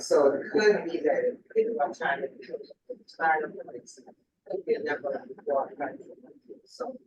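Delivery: muffled, distant mic sound; like talking from underwater. Real, not acted. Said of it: "It sounds like you're speaking on the water".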